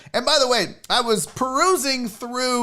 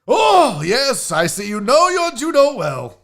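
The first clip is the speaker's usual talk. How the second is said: high, loud English gentleman; pompous English approval, hammed up; English accent; 5 semitones higher, 7 dB louder than usual. Host as a dapper gentleman under arrest, grand English accent. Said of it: "Your English accent is better".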